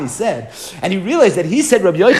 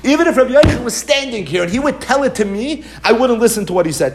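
high, half-laughing shout of refusal; defiant outburst; 7 semitones higher than usual. Acted. Voicing Rav Nachman to the class, defiant laughing shout. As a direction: throw it out like a stubborn sage refusing.

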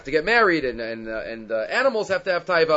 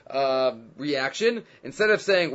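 normal talking voice

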